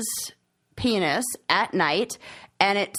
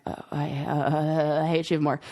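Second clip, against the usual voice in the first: disgusted aside; 7 semitones lower than usual. Retold or imitated. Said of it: "This is the part where I'm like".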